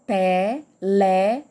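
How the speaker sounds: "Televisão" slowly split as te, le, vi, são; syllable by syllable. Shown as a demonstration. Teacher separating the word with everyone, "Televisão" said in separated syllables. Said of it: "pra separar essa palavra".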